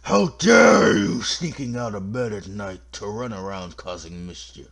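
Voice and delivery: drawn-out, booming spooky scold; scolding ghost. Acted. Ghost catching children out of bed, spooky scolding. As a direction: wail at naughty children like a ghost.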